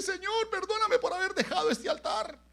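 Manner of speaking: very high, loud wailing prayer; acted sobbing supplication; imitated tearful plea. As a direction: mimic someone crying out for forgiveness. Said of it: "se arrodilló y le lloraba".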